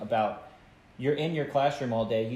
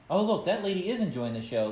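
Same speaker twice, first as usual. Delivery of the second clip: higher-pitched, playful mock admiration; joking call-out; 7 semitones higher than usual. Magician teasing lady on her phone, joking call-out. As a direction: point someone out to the crowd as a joke. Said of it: "I think I made a joke like".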